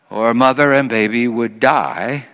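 ordinary talk, nothing marked

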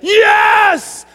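high, loud triumphant yell; hammed-up shout of joy; mock cheering. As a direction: cheer like a whole hyped-up church. Acted congregation cheering the promise.

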